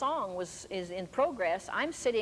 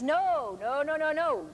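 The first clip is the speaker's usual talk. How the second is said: loud insistent no's, pitched up; firm repeated no; 6 semitones higher, 3 dB louder than usual. Retold or imitated. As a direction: shut it down hard. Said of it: "And I says".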